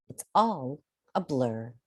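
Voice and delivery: ordinary delivery, nothing marked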